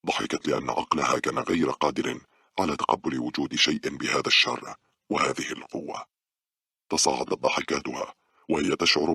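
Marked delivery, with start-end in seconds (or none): none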